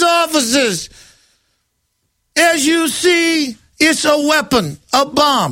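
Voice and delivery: fake-polite setup to the officers; smug thug, lightly voiced; mock goon. Mocking a goon framing protester.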